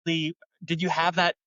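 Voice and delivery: ordinary talk, nothing marked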